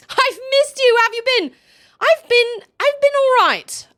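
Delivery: loud, very high-pitched gush; gushing greeting. Real, not acted. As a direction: squeal it to a missed friend.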